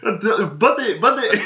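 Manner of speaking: high, loud imitated shouting of buddy; hammed-up flustered clerk; hammed-up flustered clerk calling buddy; mock gas station owner.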